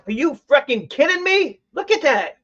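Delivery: can't believe the find; excited disbelief; thrilled disbelief. Real, not acted.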